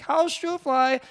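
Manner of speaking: put-on high, loud old lady's question; old Russian lady. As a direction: ask sweetly like an elderly Russian woman. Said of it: "She was an old Russian lady".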